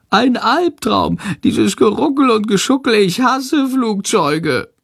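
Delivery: high wail with drawn-out words; whining. A narrator's reading. Bat moans about the bumpy take-off, high and whining. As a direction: miserable little bat complaining, slow and high. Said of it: "jammert Shandor".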